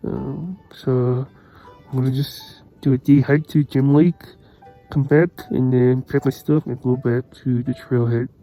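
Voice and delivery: talking over loud wind outdoors; windy. Real, not acted. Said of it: "You can hear the wind is so windy".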